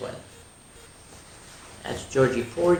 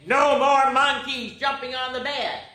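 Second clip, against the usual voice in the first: doctor's stern voice; 9 semitones higher than usual. Acted.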